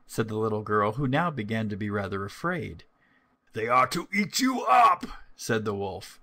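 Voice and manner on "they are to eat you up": high, loud shout building to a pounce; wolf's menacing shout. A narrator's reading. Wolf about to eat the little girl, loud menacing shout. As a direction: shout it as the wicked wolf attacks.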